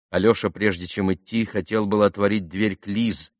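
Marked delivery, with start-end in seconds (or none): none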